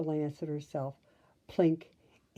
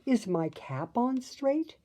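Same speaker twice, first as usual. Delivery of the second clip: mild worried cry; 7 semitones higher than usual. A narrator's reading. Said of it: "she cried".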